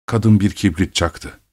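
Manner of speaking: ordinary delivery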